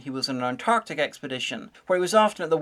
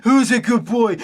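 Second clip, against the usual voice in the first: put-on much higher, louder gushing baby talk to a dog; excited dog talk; 7 semitones higher, 7 dB louder than usual. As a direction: gush over the dog like a pet owner. Talking to the ship's dog.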